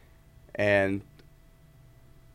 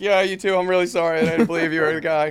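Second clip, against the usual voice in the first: high, loud acted apology; sheepish retold apology; 9 semitones higher, 7 dB louder than usual. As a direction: apologise in an embarrassed gush, playing it up. Retold embarrassed apology to star.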